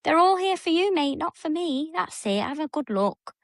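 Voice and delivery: high sing-song voice for the cat; pet talk. Real, not acted. Host cooing high to her cat Bandit.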